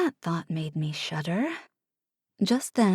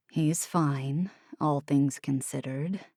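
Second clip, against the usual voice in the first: Oliver, low and soft; 5 semitones lower, 5 dB quieter, slower than usual. Acted. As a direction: lower voice, softer.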